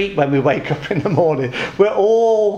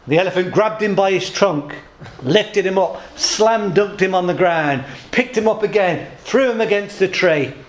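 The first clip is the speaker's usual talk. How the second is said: ordinary talk, nothing marked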